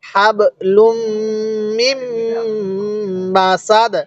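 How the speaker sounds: long hums on লুম and মিম, crisp stop on সাদ; slow model reading. Shown as a demonstration. Teacher having learners read along, hummed লুম and মিম. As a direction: hold each m nasally, pause between word groups. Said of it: "আমার সাথে পড়ুন".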